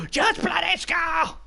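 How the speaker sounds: high, slow, exasperated shout; frustrated shout. Real, not acted. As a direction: yell at the screen in exasperation. Frustrated yell at the player.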